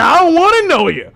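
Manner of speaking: high, loud imitation of the passenger; mock angry retort. Passenger answering back, mock angry retort. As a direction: throw it back loudly, mimicking him. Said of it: "He said".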